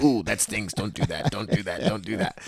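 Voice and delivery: hushed note to self; soft self-warning. Retold or imitated. Man warning himself off old pain, softly. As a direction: warn yourself under your voice, softly. Warns himself, touching his chest.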